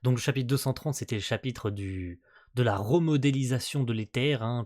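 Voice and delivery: ordinary delivery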